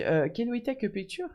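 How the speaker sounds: put-on high, hesitant English with a French accent. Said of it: "Mais avec un accent français".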